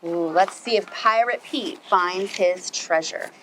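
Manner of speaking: usual speaking voice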